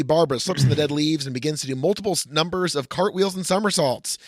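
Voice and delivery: ordinary delivery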